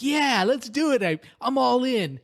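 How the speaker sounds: high, eager all-in; reckless excitement. Retold or imitated. Dreamer going all in at roulette, high and eager. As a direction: throw caution away gleefully. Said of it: "And I'm like".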